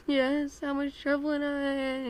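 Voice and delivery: high, loud mock wail; hammed-up whimper of a kid in trouble; mock tearful. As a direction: wail it as if dreading punishment. Acts scared kid answering mom.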